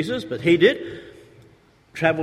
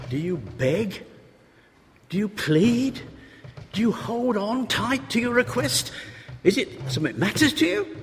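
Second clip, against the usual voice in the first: urgent preaching; 8 semitones higher than usual. Real, not acted. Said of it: "preacher pressing hearers".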